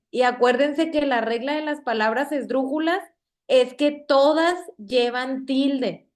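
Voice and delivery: usual speaking voice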